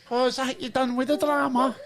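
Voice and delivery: hammed-up Scottish accent; mock Scottish accent. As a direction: put on a broad Scottish voice. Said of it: "I don't know what that accent was".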